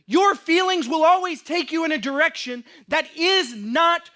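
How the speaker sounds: loud, slow, emphatic preaching; preaching at full force. Acted. Pastor urging the congregation not to trust feelings, loud slow preaching. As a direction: drive each phrase home to the congregation.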